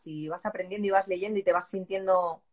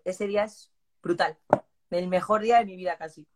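ordinary talk

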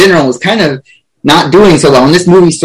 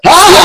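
a sudden high, louder cartoon yelp; mock startled yelp; 4 dB louder than usual. Podcaster imagining Spider-Ham bursting in, high startled yelp. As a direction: yelp like a toon jumping in fright. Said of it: "It's like".

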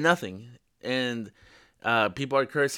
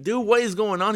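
retold alarm; 8 semitones higher, 4 dB louder than usual. Retelling his alarm at muttering driver.

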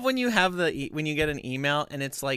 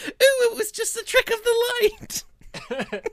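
high, breathless mocking wail; mocking high squeal; mock Ginny, higher and laughing. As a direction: squeal it like a dazzled reviewer. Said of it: "It's like".

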